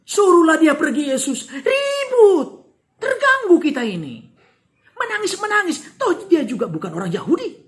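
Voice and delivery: fed-up disciples urging Jesus to send her away; annoyed complaint. Acted. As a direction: complain to get rid of her.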